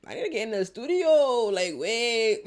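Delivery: high, loud mock-desperate yell; playful hammed-up shout; excited outburst. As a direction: burst out loudly, itching to join in. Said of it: "Like, I'm like".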